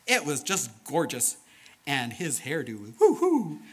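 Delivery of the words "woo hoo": high, loud woo-hoo; admiring whoop. Acted. Storyteller showing off the flower's gorgeous hairdo, high admiring whoop.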